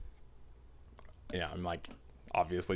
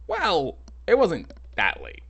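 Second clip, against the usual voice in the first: high-pitched, louder mock protest; hedging objection; 9 semitones higher, 4 dB louder, slower than usual. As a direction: push back in a squeaky, haggling voice. Haggling with the customer.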